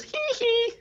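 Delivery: very high-pitched squealed "hee hee"; squeaky mock choking noise. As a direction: imitate a choked falsetto giggle-like squeal. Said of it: "chokes himself".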